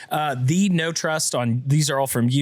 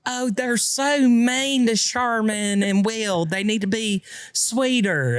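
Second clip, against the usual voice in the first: high, drawn-out doting mom voice; mock motherly pleading, stretched out; imitating mom; 7 semitones higher, slower than usual. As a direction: put on a fretting mom, pitched up and slow. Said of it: "comments that my mom reads".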